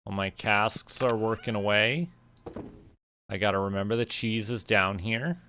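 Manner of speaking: ordinary delivery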